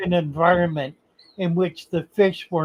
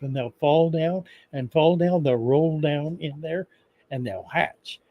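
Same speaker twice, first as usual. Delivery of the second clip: lowered Southern voice. Acted. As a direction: lean into a lazy country fishkeeper. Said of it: "I'm gonna lower my voice here and get into a little color".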